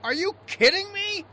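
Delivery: high, loud disbelief; exasperated yell. Acted. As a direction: blow up in disbelief. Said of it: "always shouting at me".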